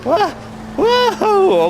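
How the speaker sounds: high, loud startled whoa; startled yelp. Real, not acted. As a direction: cry out at a near crash. Near crash while driving.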